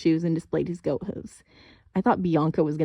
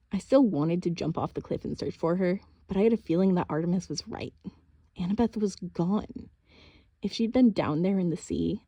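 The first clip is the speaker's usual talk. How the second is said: ordinary delivery, nothing marked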